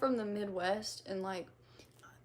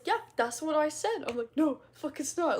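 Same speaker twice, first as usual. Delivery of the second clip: retold playful insistence; 5 semitones higher, 5 dB louder than usual. Acting out joking argument.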